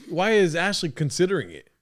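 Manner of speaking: usual speaking voice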